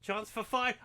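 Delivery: ordinary talk, nothing marked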